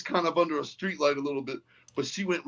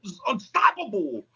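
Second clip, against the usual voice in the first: very high, held-back mock battle cry; imitation war cry, pitched way up; retold triumphant yell; 12 semitones higher than usual. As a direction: holler it high, then come down in pitch. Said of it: "and yelled with her".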